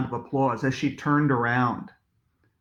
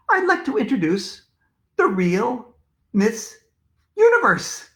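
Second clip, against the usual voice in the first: high, slow announcer build-up; grand reveal of a special guest; stage introduction; 8 semitones higher, 3 dB louder than usual. A narrator's reading. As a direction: draw out the pauses, then burst on the name.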